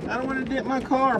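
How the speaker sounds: high whiny mock voice with a laugh; mocking a scared driver. Trucker mocking drivers scared of hail, whiny high mock. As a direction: sneer it in a baby voice. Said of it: "for being a little scared".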